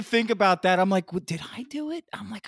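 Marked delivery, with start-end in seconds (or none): 1.1-2.0 high, soft questioning; puzzled self-questioning. Retold or imitated. Host asks himself about the broken car, puzzled. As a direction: wonder aloud, pitched up.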